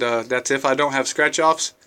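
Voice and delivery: usual speaking voice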